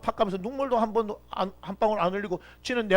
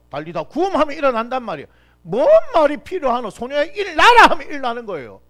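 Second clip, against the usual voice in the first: loud, higher sermon cry; preaching climax; 6 semitones higher, 10 dB louder than usual. Real, not acted. Pastor proclaiming Jesus' power to the congregation, loud preaching. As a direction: press the point with rising force.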